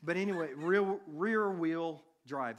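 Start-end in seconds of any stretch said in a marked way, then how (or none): 1.1-2.6 loud, drawn-out "rear-wheel drive"; slow down and punch out "rear-wheel drive"; careful pronunciation. Real, not acted. Preacher correcting his stumble on "rear-wheel drive", carefully enunciated. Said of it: "That's kinda hard to say".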